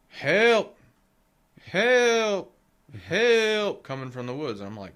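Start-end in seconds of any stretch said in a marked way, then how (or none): 0.1-3.8 high, loud drawn-out shout; retold cry for help. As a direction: imitate someone calling for help from far off. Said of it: "I start hearing".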